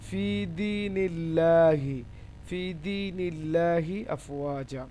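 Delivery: ফি দীনিল্লাহি slow, long "dee" and light "laa"; thin Lam pronunciation. Shown as a demonstration. Teacher gives a thin example, ফি দীনিল্লাহি slow with light Lam. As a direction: stretch ফি দীনিল্লাহি with a thin open L syllable. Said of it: "পাতলা বা বারিক হয়".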